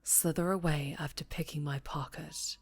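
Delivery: a crime boss toying with his prisoner, calm and taunting; menacing mob boss. Acted.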